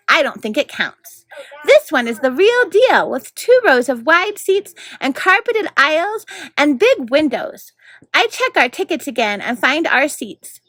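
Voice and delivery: lively read-aloud narration; story narration. A narrator's reading. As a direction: read it like a bedtime chapter. Narrator reads chapter aloud.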